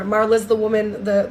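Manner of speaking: ordinary talk, nothing marked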